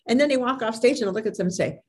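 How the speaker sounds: ordinary delivery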